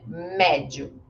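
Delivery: "Médio" with a wide eh in the first syllable; open E. Shown as a demonstration. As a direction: say "Médio" as MEH-dyoo. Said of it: "Ele é um E mais forte e aberto".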